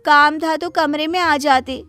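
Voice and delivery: high, loud and irritated; a spoiled daughter barking back at her father; annoyed shout. Acted. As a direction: yell back at him, fed up.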